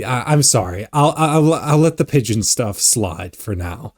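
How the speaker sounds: ordinary delivery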